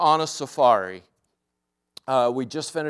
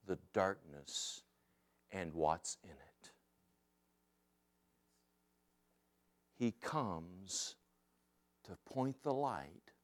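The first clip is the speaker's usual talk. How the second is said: much softer and slower, hushed sermon voice; quiet reflective preaching; 5 semitones lower, 8 dB quieter, slower than usual. Acted. Pastor drawing the story's lesson for the congregation, softly and slowly. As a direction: let each phrase settle gently with long pauses.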